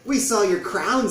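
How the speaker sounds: high loud slow goblin chorus; loud eager goblin crowd. A narrator's reading. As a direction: call it out amazed. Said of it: "said the other goblins".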